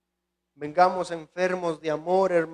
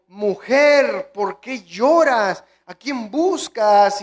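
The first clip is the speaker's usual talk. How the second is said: Jesus asking; 4 semitones higher, 8 dB louder, much slower than usual. Acted. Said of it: "Jesús le dijo".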